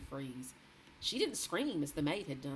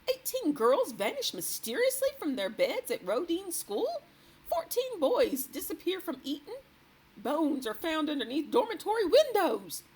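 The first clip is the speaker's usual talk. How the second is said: higher, louder horrified reading; reading aloud shocked; 12 semitones higher, 9 dB louder than usual. A narrator's reading. The queen reading the headlines aloud, horrified. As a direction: read each headline with dismay.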